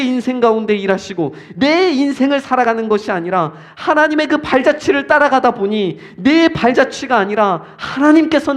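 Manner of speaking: fervent preaching. Real, not acted. Urging listeners to follow God boldly, fervent preaching. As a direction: drive each phrase home with conviction. Sermon climax at full force.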